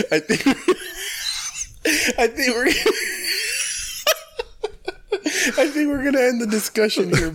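high voice breaking into laughter; real laughing fit. Real, not acted. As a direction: try to quote through helpless giggles. Cracking up while retelling the quote.

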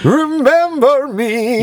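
slow falsetto mimicry; high mock echo. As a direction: stretch it high and silly.

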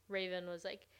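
ordinary delivery

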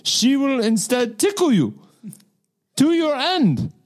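hammed-up Russian accent, rising to the end; mock Russian accent. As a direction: spin the tale in a bad Russian accent. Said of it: "That's pretty good".

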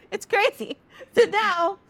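high voice breaking into real laughter; laughing. Real, not acted. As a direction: squeeze the words out through giggles. Teller cracks up at story.

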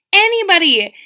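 Anybody thrown out high and loud, then cut off; emphatic outburst. Real, not acted. Venting to viewers about fake smiles, loud emphatic Anybody. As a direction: hit Anybody hard to start the rant.

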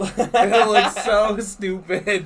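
slow, stretched through laughter; laughing. Real, not acted. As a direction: laugh while saying it. Laughing at friend's face.

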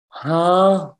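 high, breathless huh; disbelieving gasp of huh; stunned huh. Retold or imitated. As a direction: act floored by the words. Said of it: "And I'm just like".

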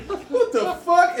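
high squeaky startled bull; laughing high bull voice; shocked. Acted. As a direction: squeal it out in disbelief. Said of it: "they're like".

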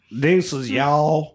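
slow drawn-out Southern drawl on "y'all"; Southern accent. Shown as a demonstration. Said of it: "Is that Southern enough?".

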